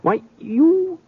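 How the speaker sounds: high-pitched cry of disbelief; startled disbelief. Acted. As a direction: voice jumps way up in shock. Accused man cries out in disbelief.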